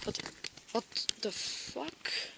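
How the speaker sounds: slow, barely audible disbelief; stunned whisper. Acted. Victim seeing the monster return, stunned whisper. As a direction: whisper it slowly in shock. Acted horror, monster reappears.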